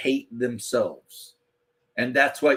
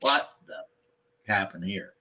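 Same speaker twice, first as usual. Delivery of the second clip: low, slow dismayed mutter; slow, low disbelief at a ruined city; 6 semitones lower, 4 dB quieter, slower than usual. Retold or imitated. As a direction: ask it like you can't believe the ruin. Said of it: "and it's like".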